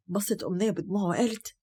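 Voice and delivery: usual speaking voice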